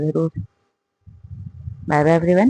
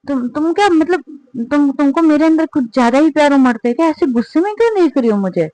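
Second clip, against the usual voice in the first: put-on higher, fast drunken babble; higher, fast slurred drunk protest; tipsy protest; 7 semitones higher, faster than usual. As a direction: talk fast and tipsy, pushing back. Drunk girl protesting partner's glare.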